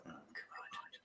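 breathless unvoiced groan of dread; whispered dread. Asked about his past, he whispers to himself in dread.

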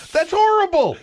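loud, high protest; laughing outrage. Real, not acted. Co-host scolding host over clip, loud protest. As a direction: cry out high and loud in reproach.

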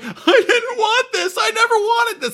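high, slow whimpering protest; mock a crying dad who didn't want this; high sobbing whine, hammed up; whiny mock crying. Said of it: "He's like".

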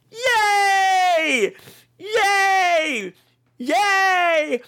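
high, gleeful cheer. Real, not acted. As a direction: cheer like a game show host. Celebrating the winners.

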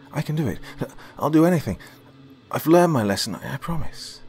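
high, urgent promising; sincere pleading. Acted. Girl begging the artist to let her fix it. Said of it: "I sincerely reassured".

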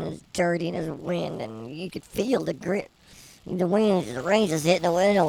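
ordinary talk, nothing marked

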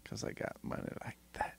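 unvoiced whisper. Real, not acted. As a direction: whisper it low. Whispered boast.

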